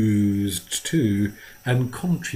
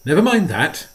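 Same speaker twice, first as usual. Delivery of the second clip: sharp, higher and louder retort; snapped; 6 semitones higher, 7 dB louder than usual. A narrator's reading. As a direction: snap it off impatiently. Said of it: "Maitland snapped".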